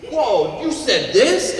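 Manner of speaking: high, loud shocked "whoa, you said this?"; mock shock. Listener catching an F-bomb in the message, acting shocked. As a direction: feign outrage at the swear word.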